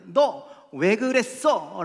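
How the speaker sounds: loud, high, drawn-out demand; acted parental scolding. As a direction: scold like a parent confronting a child. Voices a parent scolding a child.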